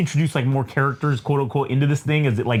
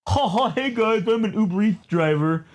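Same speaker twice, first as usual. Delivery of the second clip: pretend vlogger driver; 5 semitones higher than usual. Fake delivery driver greeting his viewers, lightly mocked. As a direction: fake chuckle, lightly put-on cheery host. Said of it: "they're just pretending they're like".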